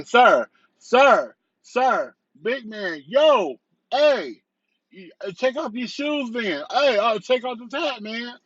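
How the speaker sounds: high, insistent calling out to a passing shopper; pushy street vendors calling out. Retold or imitated. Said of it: "They still say".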